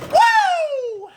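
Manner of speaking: big high whoop from the cold shock; loud whoop. Real, not acted. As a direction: let out a loud startled whoop. Plunging head under icy water.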